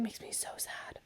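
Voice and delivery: sad little whisper. Real, not acted. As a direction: whisper it sadly. Sad aside about shop closing.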